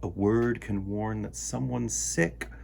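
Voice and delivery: put-on lively storytime read for kids; reading a picture book aloud. A narrator's reading. Host reading a word book to children, lively storytime voice.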